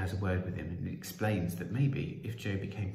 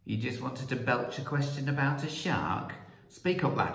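higher-pitched, incredulous demand; shouted; 5 semitones higher than usual. Acted. Prime Minister mishearing Joe's question, mildly shouted. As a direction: call out impatiently at the boy. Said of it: "the Prime Minister shouted".

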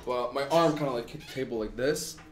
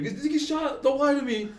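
high-pitched frantic interrogation; panicked, accusing, voice way up; retold screaming; 12 semitones higher than usual. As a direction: imitate a sister shrieking down the phone. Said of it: "all I hear is screaming".

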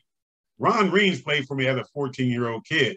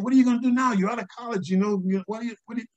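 higher, a hint of a worried mother; voicing his mother; 9 semitones higher, faster than usual. Mother questioning her son after college, lightly voiced worry. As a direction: lightly play mom pressing her son. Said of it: "she said".

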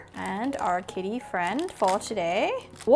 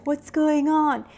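slow, higher-pitched puzzled cat voice; bewildered cat; 4 semitones higher, 4 dB louder than usual. Acted. Sleeping cat disturbed by mice, slow bewildered voice. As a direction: draw it out as a confused cat. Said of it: "And then the cat's like".

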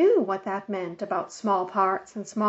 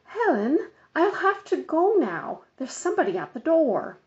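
higher, brisk polite excuse; hurried Mother; 7 semitones higher than usual. A narrator's reading. Mother cutting Helen off to escape the phone call, brisk. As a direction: wrap up the call, eager to hang up.

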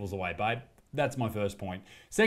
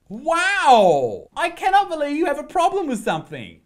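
high, loud mock amazement; sarcastic shock; 10 dB louder than usual. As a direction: gasp in fake disbelief at her. Sarcastic reaction to clip.

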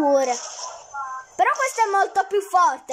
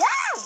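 short high shriek; startled scream. Real, not acted. Gamer finding out it's a zombie map, high shriek. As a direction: yelp high at a sudden fright.

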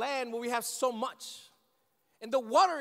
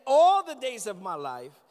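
"all the days of my life" repeated louder, leading the congregation; louder call to repeat; 9 dB louder than usual. Shown as a demonstration. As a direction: say it again with more force so they join in. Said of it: "Say with me again".

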